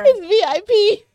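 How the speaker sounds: very high, loud laughing boast; giddy squeal; squealed joke. Real, not acted. Joking boast about her quote.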